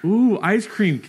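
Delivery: food TikTok voice. Pretend. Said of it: "And you're like".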